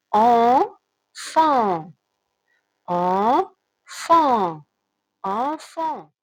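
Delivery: "Enfant" repeated with open mouth on the nasal vowel; bien articulé. Shown as a demonstration. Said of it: "J'ouvre la bouche pour le prononcer". ("Enfant" répété, bouche ouverte sur le son en)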